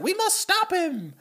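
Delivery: very high, slow, grand Piggy voice; Miss Piggy. Retold or imitated. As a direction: declare it like a diva pig. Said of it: "Miss Piggy's like".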